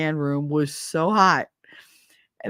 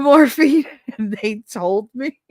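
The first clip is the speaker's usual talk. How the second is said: normal talking voice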